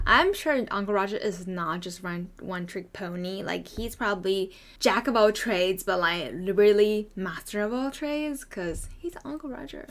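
ordinary talk, nothing marked

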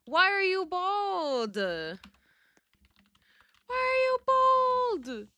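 very high, slow drawn-out whine; squeaky, stretched-out lament; mock wail. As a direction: wail it in pretend despair. Mock wail at bald character.

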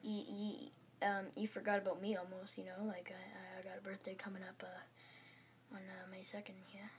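very soft, low and hesitant; timid pupil. Acted. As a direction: mumble a shy reminder, almost whispering. Timid pupil interrupts teacher about birthday.

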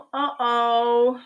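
a cartoonish oops for the kids; playful uh-oh. Refrain voiced by book character.

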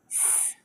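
स said softly, slowly and tense, with a long hiss; tense double s. Shown as a demonstration. Korean teacher to viewers, showing double s: soft, slow tense स. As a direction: stretch the स out softly, tight and hissing. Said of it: "ये double एस का sound देगा".

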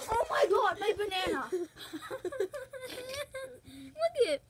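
high, loud laughing squeal; cracking up mid-sentence. Real, not acted. Cracking up over her banana.

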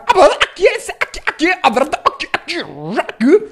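frantic broken squawks, partly voiceless; choked, stuttering record noises; vocal scratch. Shown as a demonstration. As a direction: scratch the syllables like a DJ. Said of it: "y empieza".

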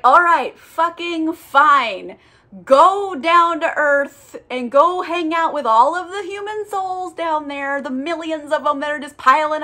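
mock exasperation, dragging the words out; exasperated concession. God grudgingly sends the angel down, exasperated.

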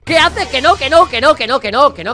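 high, loud frantic protest; panicked shouting. Real, not acted. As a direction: panic as the game takes over. Game searches his computer, panic.

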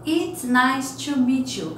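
slow, careful "It's nice to meet you"; teacher drilling the phrase; slow model. Shown as a demonstration. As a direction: spell it out slowly. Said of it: "teacher models phrase slowly".

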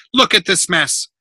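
loud, high exasperated order; angry scolding. A narrator's reading. Builder pointing out the mess to the boy, angry scolding.

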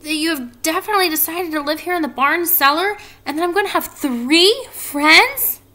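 high, loud, eager question; Wilbur's excited voice. A narrator's reading. Wilbur hopes for three new friends, high and excited. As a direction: let hope push the voice up. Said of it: "asked Wilbur".

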